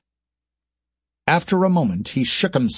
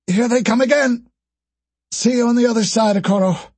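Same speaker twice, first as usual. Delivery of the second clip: female soldier voice; 11 semitones higher than usual. Acted. Verley's last stand.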